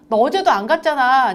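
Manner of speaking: loud mock parental reproach; scolding parent. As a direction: act out a mother telling off her kid. Voicing parent scolding the child.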